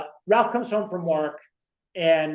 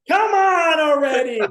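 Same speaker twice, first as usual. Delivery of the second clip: a high, loud, drawn-out cry of frustration; exasperated shout; 10 semitones higher, 8 dB louder, slower than usual. Retold or imitated. Ralph to Norton who keeps asking for food, a high exasperated shout. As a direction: yell it like he's at the end of his rope.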